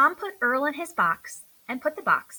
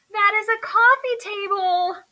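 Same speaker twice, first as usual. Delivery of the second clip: higher, louder exasperated shout; 9 semitones higher, 8 dB louder than usual. Acted. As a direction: yell the obvious correction. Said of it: "shouted Buddy".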